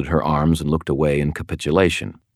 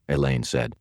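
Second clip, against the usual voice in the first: ordinary delivery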